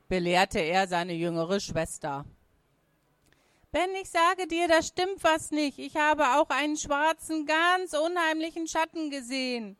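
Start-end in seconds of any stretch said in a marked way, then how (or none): 3.7-9.7 put-on high-pitched, agitated girl; urgent, high young voice; insistent little sister. A narrator's reading. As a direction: plead to be believed in a high child's voice.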